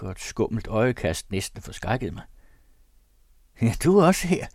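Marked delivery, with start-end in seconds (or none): 3.6-4.5 higher, louder sneering greeting; mocking hello to an old acquaintance; strange grin. A narrator's reading. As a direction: say it through an odd leering grin. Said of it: "sagde han med et sært grin".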